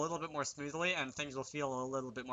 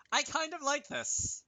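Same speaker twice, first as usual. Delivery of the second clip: much higher and slow, a squeaky playful voice; 12 semitones higher, slower than usual. Pretend. Pleased with the dog's-eye view, a high slow squeaky voice. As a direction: squeak it out slowly like a delighted cartoon pup. Reacting to the game in play.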